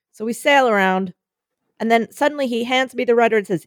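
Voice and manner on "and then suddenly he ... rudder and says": ordinary talk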